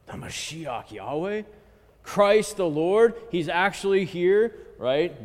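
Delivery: sharp gasp, then an awed question; shepherds' astonishment. Retold or imitated. Teacher acting the shepherds stunned by the angel's news. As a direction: gasp and ask it in hushed disbelief.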